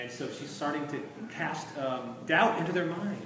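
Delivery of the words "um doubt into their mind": ordinary talk, nothing marked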